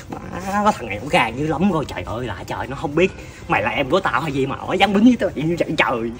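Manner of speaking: low, hushed fuming; seething muttering. Acted. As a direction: grumble furiously under your breath. Uncle fuming over nephew's beating.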